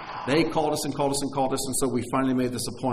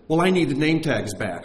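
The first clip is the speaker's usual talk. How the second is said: put-out salesman; 3 semitones higher, 7 dB louder than usual. Acted. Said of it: "when he saw we were emphatic".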